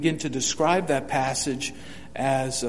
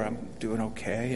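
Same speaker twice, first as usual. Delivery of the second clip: subdued tone; 5 semitones lower, 9 dB quieter than usual. Shown as a demonstration. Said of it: "people can pick up from the tone".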